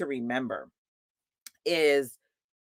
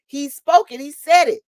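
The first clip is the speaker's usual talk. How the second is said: emphatic; 9 semitones higher, 9 dB louder than usual. Real, not acted. Emphatic preaching.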